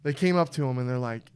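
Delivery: usual speaking voice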